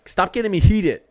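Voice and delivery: telling chat to stop winding him up; half-laughing heated complaint. Real, not acted. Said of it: "I gotta stop ranting".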